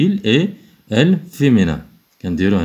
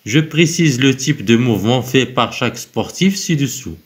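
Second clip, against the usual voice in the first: higher, slow dictation-style reading; slow instruction read; 4 semitones higher, much slower than usual. Acted. Teacher reading the exercise instruction to pupils, slow and clear. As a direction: read the task aloud slowly for the class.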